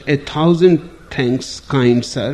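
usual speaking voice

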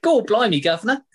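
loud, high, hammed-up Cockney; exaggerated Cockney catchphrase, played for laughs; mock Cockney accent. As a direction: call it out like a cartoon Cockney. Joking stock British catchphrase, others laugh.

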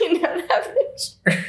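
high, slow words squeezed through real laughter; laughing too hard. Real, not acted. Storyteller retrying her line, laughing too hard. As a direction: struggle to get it out while cracking up. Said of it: "Already doing it".

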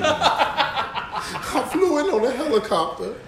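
slow laugh-filled quote. Retold or imitated. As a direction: drag it out laughing. Quoting R. Kelly laughing.

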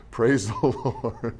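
soft, squeaky giggling praise; laughing praise. Real, not acted. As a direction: let the laugh break into falsetto. High falsetto laugh.

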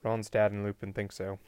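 ordinary delivery

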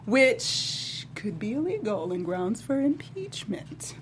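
slow hushed whisper. Real, not acted. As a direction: lean in and whisper it. Confiding aside on legality.

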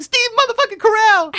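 piercingly high, very loud mock shriek; retold freaked-out scream. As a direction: shriek the name like an overjoyed street seller. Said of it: "screaming down the street".

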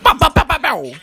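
loud, high mouth gunshots; gunshot sounds. Acted. S0 doing rapper gunshot ad-libs, loud. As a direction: fire off pows like a hype man. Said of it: "I'll do, like, gunshot sounds".

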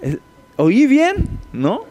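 high, slow, sarcastic did-I-hear-right; mock disbelief. Demon taunting Jesus, high and slow mock disbelief. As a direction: stretch it out in fake shock. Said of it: "le dice así como de".

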